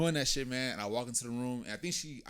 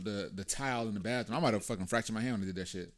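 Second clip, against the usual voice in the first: ordinary talk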